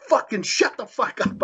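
high-pitched, exasperated retort; retold angry outburst. Wrestler retells confronting his opponent backstage, in heated indignation. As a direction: re-enact cutting someone off, pitch jumping up.

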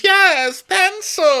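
very high and loud; mock Diana. Podcaster mocking Diana's answer, very high and loud. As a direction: shriek yes, pencil. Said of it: "she goes".

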